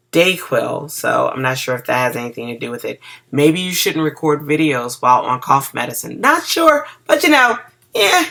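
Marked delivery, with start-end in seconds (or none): 6.2-8.2 playful sheepish. Real, not acted. Jokes about recording on cough medicine.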